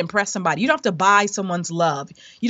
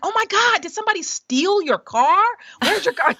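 much higher, imitating startled concern; alarmed boyfriend; 11 semitones higher than usual. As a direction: retell his panic in a raised, mock-alarmed voice. Boyfriend's alarmed reaction retold.

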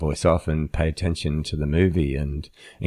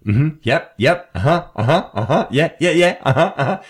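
high, loud volley of agreeing noises; exaggerated eager uh-huhs on a rising note; over-eager listener; 10 semitones higher, 5 dB louder than usual. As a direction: imitate a nonstop interjecting listener, amused. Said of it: "this is what you do".

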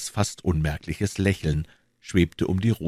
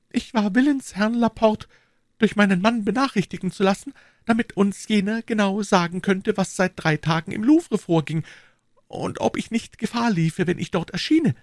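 put-on young woman's voice, pitched very high; high-voiced lady confiding in a gallant; Madame Bonacieux. A narrator's reading.

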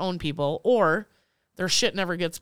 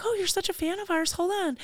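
much higher-pitched voice for the quoted rep; imitated friendly vendor; 12 semitones higher than usual. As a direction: play the vendor in a high voice. Vendor voiced in retold story.

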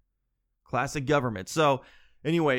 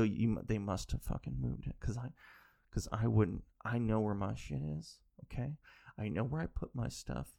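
muttered grumble; 3 semitones lower, 7 dB quieter than usual. Retold or imitated. Muttering blame at partner.

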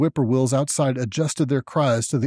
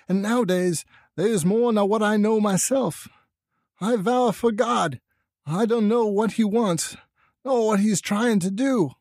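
much higher, troubled country-woman voice; Lavinia; 8 semitones higher than usual. A narrator's reading. Mother afraid of her son, high worried rural voice. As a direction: plead ignorance in high rustic dialect. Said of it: "complained to Mamie Bishop of being afraid".

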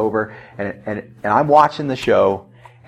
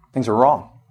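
normal talking voice

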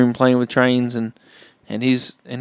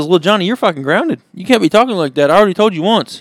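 raised, louder mock telling-off; scolding mom; 6 semitones higher, 5 dB louder than usual. Mom grounding little Johnny for swearing, acted scolding. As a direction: scold like a fed-up mother in a joke.

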